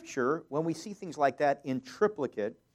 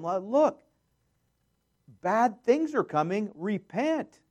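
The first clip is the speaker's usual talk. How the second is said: urgent warning; 7 semitones higher, 4 dB louder, slower than usual. Acted. God warning the people.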